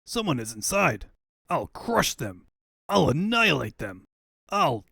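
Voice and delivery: loud, rising villain rage; furious threats. Acted. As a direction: threaten them at full volume, seething. Villain raging at intruders.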